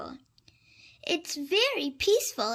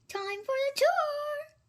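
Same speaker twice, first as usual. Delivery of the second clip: high, bright librarian voice; a hint of a cheerful librarian; lightly voiced librarian; 14 semitones higher than usual. A narrator's reading. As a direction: invite him along cheerfully. Librarian speaking.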